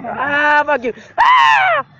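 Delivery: loud high furious scream; raging gamer. Real, not acted. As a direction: scream curses at the screen. Killed, screaming.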